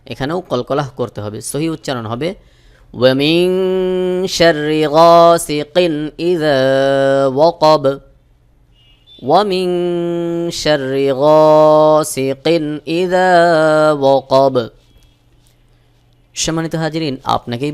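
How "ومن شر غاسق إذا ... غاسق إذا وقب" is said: very slow chanted recitation with ghunnah; slow Quran recitation. Shown as a demonstration. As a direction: draw out each word in tajweed. Recites the verse. (ধীরে তাজবীদসহ তিলাওয়াত)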